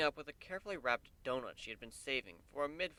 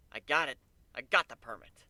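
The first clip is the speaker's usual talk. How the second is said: excited; 2 semitones higher, 8 dB louder than usual. A narrator's reading.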